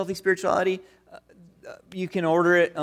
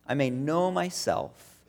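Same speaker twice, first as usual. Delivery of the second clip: prayer; 5 semitones lower, slower than usual. Retold or imitated. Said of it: "And then he prays this".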